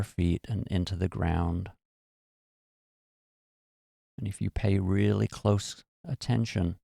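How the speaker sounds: calm, unhurried meditation voice with long pauses; guided meditation. Acted. Teacher guiding listeners through relaxing their bodies, calm and measured. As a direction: ease them gently down, phrase by phrase.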